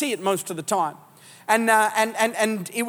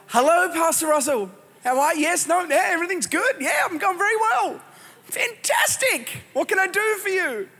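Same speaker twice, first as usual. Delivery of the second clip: much higher, syrupy phone voice; fake cheerful; 7 semitones higher than usual. Angry churchgoer answers the pastor's call all sweetness. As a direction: act all fine for the pastor.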